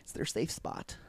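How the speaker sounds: whispered reply; hushed, almost silent. Real, not acted.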